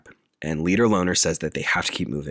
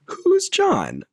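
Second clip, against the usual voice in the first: very high, louder feigned cluelessness; mock nonchalant; 5 dB louder than usual. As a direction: play dumb in a high, airy voice. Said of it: "very nonchalant and deadpan way".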